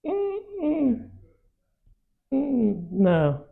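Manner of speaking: high, sceptical humming then a flat no; unconvinced, pulling back; doubtful listener. Retold or imitated. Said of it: "people get a little bit".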